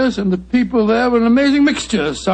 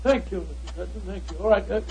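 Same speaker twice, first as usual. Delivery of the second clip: much softer, heard from across the room; off-mic distance; 8 dB quieter than usual. Acted. Watson greeting the lady at the top of the stairs, far off mic. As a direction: call it from the landing, away from the mic.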